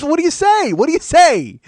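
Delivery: high, loud, panicky imitation of a flustered girl; a hammed-up nervous squeal. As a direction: mimic her rattled, squeaky alarm. Flustered Leanna facing his rage.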